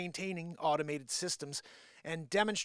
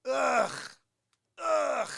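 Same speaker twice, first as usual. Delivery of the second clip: disgusted groan; 7 semitones higher, 5 dB louder than usual. Real, not acted. Disgust after mock pitch.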